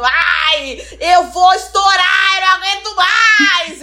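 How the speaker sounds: hammed-up meltdown, stretched and shouted; mock exasperated outburst. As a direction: play someone dramatically about to erupt. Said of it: "todo dia tem algum tweet falando".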